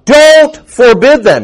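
loud, slow, high command; stern command. Retold or imitated. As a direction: slowly bark it as an order. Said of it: "He says".

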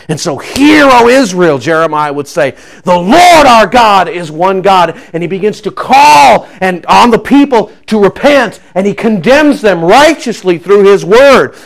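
loud, high, driving sermon voice; full-force preaching. Acted. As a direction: preach with fire, building hard. Preaching climax on Jeremiah.

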